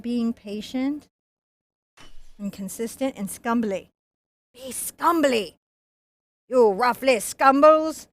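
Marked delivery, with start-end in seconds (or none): 4.5-8.1 slow, loosely slurred pirate voice, only lightly put on; a hint of Captain Jack Sparrow. Painter goofing as a pirate to the brush, light pirate voice. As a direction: play a lazy pirate urging the brush. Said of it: "Captain Jack Sparrow says".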